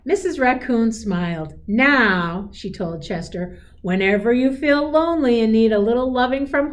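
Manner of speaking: gentle storybook read-aloud; a teacher reading a picture book aloud; reading to children. A narrator's reading. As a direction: read it like a bedtime story to a class.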